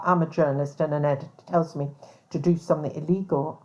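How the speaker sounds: usual speaking voice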